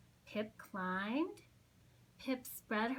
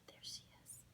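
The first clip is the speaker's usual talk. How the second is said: whisper; 18 dB quieter, faster than usual. Real, not acted. Aside to listener.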